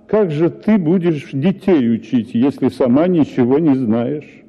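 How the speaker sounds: usual speaking voice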